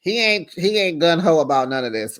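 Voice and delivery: ordinary delivery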